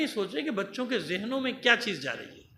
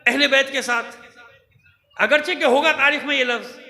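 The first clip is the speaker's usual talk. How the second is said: louder preaching with laughs between; impassioned preaching; 6 semitones higher, 7 dB louder than usual. Real, not acted. Preacher scolding pulpit speakers, impassioned loud preaching. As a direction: drive the point home to the pulpit speakers.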